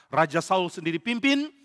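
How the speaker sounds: ordinary delivery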